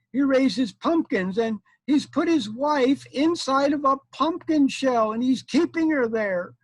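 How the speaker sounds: high, loud mock-tearful women; crying village women. Village women tell the knight about the bad man, mock crying. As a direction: wail it in a put-on high voice. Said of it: "and they were crying".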